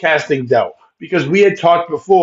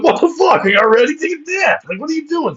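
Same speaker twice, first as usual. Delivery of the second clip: much higher, fast incredulous outburst; exasperated disbelief; 11 semitones higher than usual. Retold or imitated. Reacting to the friend's new baby-bump picture in a much higher voice. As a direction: squeal in disbelief at the friend's post. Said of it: "I'm like".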